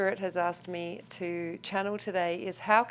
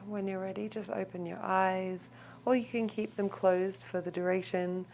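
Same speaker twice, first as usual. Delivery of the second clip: calm, softer meditation voice with long pauses; guided meditation; 6 dB quieter than usual. Real, not acted. Teacher guiding the group into a clearing breath, calm meditation voice.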